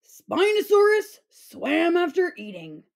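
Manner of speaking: ordinary delivery, nothing marked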